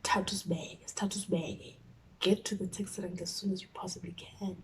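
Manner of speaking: hushed, urgent whispered instruction. Retold or imitated. Friend telling me to run, whispered urgently. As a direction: breathe it out, barely voiced.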